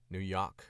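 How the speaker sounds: "New York" with R dropped, like "Noo Yawk". Shown as a demonstration. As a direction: hit "New York" hard, "York" becoming "Yahk". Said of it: "an H in place of every single R".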